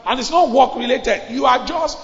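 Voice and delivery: high, loud pulpit exhortation; fiery preaching. Acted. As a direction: drive the congregation with force. Preaching at full force.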